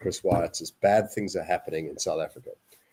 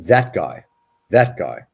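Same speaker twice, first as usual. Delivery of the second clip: louder, repeated in disgust; disgusted emphasis; 8 dB louder, slower than usual. Real, not acted. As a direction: hammer home the contempt. Disgusted outburst.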